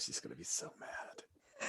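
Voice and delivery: breathy hushed whisper. Real, not acted. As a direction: whisper it like a secret. Gossip about Voyara's reaction.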